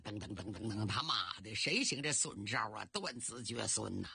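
low, muffled cursing on the run; 边跑边骂. A narrator's reading. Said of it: "有人一边跑一边骂大街呀". (边跑边低声骂骂咧咧)

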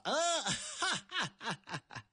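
high burst of ha ha fading away; mocking laugh. Chess player laughing at the shouting soldiers, high laugh fading. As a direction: laugh at the soldiers then trail off. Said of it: "下棋那位乐了".

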